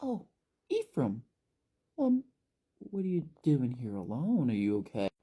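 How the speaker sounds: overplayed worried friend; dramatic entrance. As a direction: sweep in with mock concern. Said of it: "real dramatic-like".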